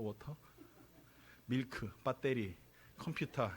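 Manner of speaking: "워터, 밀크, 배터리, 컴퓨터" with every Korean syllable sounded out; Koreanized English pronunciation. Shown as a demonstration. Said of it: "저희는 이렇게 배웠어요".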